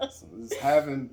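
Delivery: laugh, then carry on talking. Real, not acted. Guest laughing at her own joke about visits.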